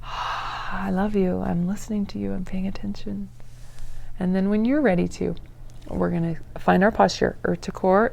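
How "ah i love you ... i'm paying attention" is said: softer, hushed whisper of reassurance; gentle soothing whisper to your own body; tender whisper to oneself. Shown as a demonstration. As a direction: whisper it kindly to your nervous system. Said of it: "So we go".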